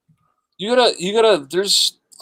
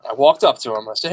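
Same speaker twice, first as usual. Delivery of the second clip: ordinary delivery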